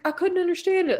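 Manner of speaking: mock husband. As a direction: imitate him complaining. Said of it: "And he's like".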